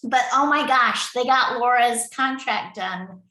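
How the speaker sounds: excited. Real, not acted. Project lead telling contractors the contract is finally done, excited. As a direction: burst out with the good news.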